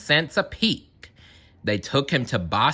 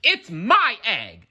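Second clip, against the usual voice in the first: higher slow sputter; 8 semitones higher than usual. A narrator's reading. Maisie fighting for the egg, higher sputter. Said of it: "she sputtered".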